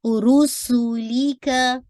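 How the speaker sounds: रुसूली का slowly, each letter given one count; slow recitation demo. Shown as a demonstration. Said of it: "लाम की कसरा sharp हो".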